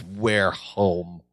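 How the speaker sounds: low, quiet, worn-out mock voice; exhausted. Larry arriving home from a false alarm, exhausted. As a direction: drop it low and soft, imitating weariness.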